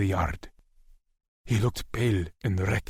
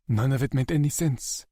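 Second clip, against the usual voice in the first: usual speaking voice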